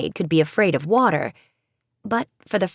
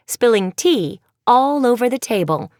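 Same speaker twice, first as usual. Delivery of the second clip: plain reading voice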